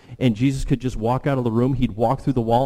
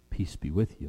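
soft blessing; 4 semitones lower, 7 dB quieter than usual. Acted. Jesus blessing quietly.

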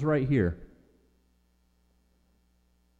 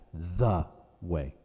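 low, weighty stress on "The"; "The" drawn out as the one and only; 6 semitones lower, 3 dB quieter than usual. Shown as a demonstration. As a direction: make "The" emphatic, lingering on it.

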